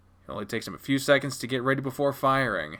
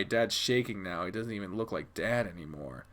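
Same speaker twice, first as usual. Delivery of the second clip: ordinary delivery, nothing marked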